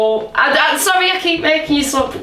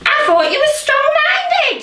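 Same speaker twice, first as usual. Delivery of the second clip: much higher squawky parrot voice; 13 semitones higher than usual. Pretend. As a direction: parrot it back, high. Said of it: "you talk like a parrot".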